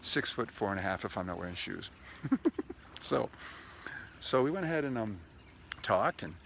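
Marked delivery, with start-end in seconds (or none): 1.9-2.7 high laugh. Real, not acted. Laughs at own height joke.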